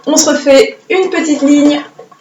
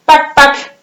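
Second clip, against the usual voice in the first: mock stroke sounds; 4 semitones higher than usual. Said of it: "il y en avait qui me faisaient ça".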